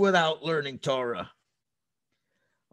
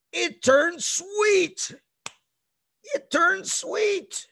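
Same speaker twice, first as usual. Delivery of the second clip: high, slow, drawn-out wonder; amazed reveal; 12 semitones higher, much slower than usual. Acted. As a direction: savour the miracle. Announcing the miracle.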